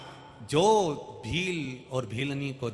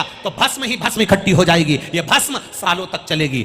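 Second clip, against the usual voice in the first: loud, raised, urgent storyteller's wife; impassioned plea; 8 semitones higher, 8 dB louder than usual. Acted. Wife begging husband to accept her sacrifice, impassioned plea. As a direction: plead at full force, driving on.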